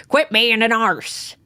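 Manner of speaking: lightly mock an old grandpa calling out slowly; hollering grandpa. Co-host imitates grandpa hollering across, mock grandpa holler. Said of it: "like, your grandpa, like, hollers at you".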